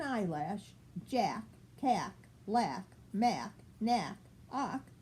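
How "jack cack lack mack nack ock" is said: ordinary delivery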